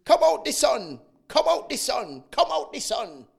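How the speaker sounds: high loud calling granny voice; granny calling out, fading. Retold or imitated. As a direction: call the child in like granny. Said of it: "my granny used to say".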